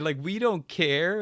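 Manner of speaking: high, drawn-out dismissal; mock mean airline staff. Airline staff to a late traveler, mock mean. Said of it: "were just being mean to him".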